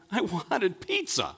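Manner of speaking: put-on high, drawn-out little-kid whine; pouting child. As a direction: pout like a disappointed toddler, slowly. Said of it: "he pouted and loudly replied".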